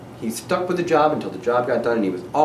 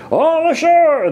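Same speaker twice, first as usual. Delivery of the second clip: high, loud, drawn-out sailors' call; shout; 6 dB louder, slower than usual. A narrator's reading. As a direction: call it out across the ship. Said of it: "the men shouted".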